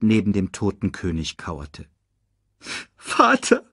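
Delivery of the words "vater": high, plaintive whimper; whimpering child. A narrator's reading. Simba beside the dead king, whimpering. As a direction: whimper to a father who won't wake. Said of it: "wimmerte er".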